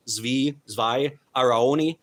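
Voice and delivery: ordinary talk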